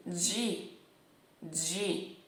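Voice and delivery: ordinary talk, nothing marked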